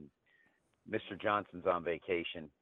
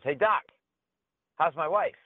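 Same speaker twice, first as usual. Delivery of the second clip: higher, louder cheery caller; chipper husband; 10 semitones higher, 7 dB louder than usual. Acted. Husband calling his wife's doctor, cheery and higher. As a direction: greet the doctor brightly, like nothing happened.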